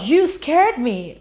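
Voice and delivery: "you scared me" called out high and loud, each word slowed and stretched; relieved loud scolding. Retold or imitated. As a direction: let the fright burst out as you shout it slowly. Leah finds Gabe alive after panic.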